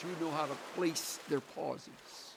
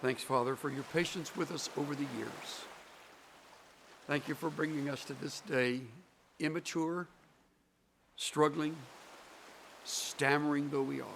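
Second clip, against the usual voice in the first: prayer; 6 dB quieter than usual. Acted. Preacher praying for the congregation, soft hushed prayer. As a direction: pray gently to close.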